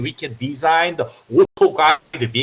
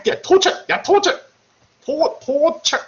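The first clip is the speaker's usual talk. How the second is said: torture said much higher and louder, relishing it; mock villain glee; 11 semitones higher, 4 dB louder than usual. Lecturer teasing students over remote class, mock villainous glee. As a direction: savour the word like a cartoon villain.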